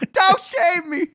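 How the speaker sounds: high, slow, wailing don't shame me; mock crying shout. Mock-wailing at the guy in the elevator, crying shout. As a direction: ham up a sobbing yell. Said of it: "and I just was like".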